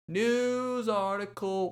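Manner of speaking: segment title. Shown as a demonstration. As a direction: announce the segment name like a jingle. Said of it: "moving it in, on in to our news article".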